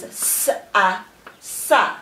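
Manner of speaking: hissed s, separate a, then "sa" as one syllable; sounding out and blending. Shown as a demonstration. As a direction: break "s-a" into sounds, then join as "sa". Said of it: "blend the syllables together".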